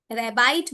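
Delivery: रैबाइट, second syllable as a long "eye" sound; long-i misreading. Shown as a demonstration. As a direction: say रैबाइट with the ending rhyming with "bite". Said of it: "तो हम इसको आइट बोलते".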